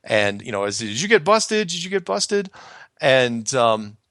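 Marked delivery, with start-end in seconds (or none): 0.8-2.5 higher-pitched imitation of pestering callers; retold urgent questioning. Callers pressing him for news, urgent retold questioning. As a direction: fire off the repeated question, pitched up. Retold anxious phone calls from others.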